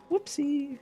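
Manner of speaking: high, sing-song whoopsie; playful oops. Real, not acted. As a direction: tease yourself lightly. Admits a small mistake.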